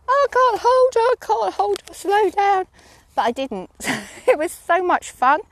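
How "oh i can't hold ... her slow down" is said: very high, squealing mock panic; mock panicked scream. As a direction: squeal it like a rider losing control. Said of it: "start screaming, saying".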